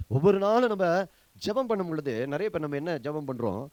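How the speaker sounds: ordinary talk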